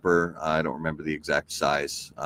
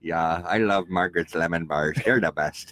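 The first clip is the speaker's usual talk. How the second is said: put-on Minnesota accent. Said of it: "when we do our Minnesota accent".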